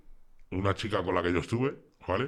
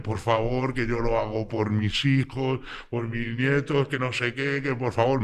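retold tearful plea; 4 semitones higher, slower than usual. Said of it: "me viene la madre llorándome".

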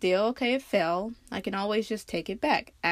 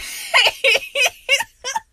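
laugh-crying; 8 dB louder than usual. Real, not acted.

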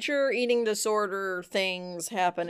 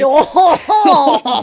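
loud, higher, hammed-up French accent; fake French accent; 4 semitones higher, 13 dB louder than usual. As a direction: belt out a mock-French exclamation. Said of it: "Basically how the two of them sound".

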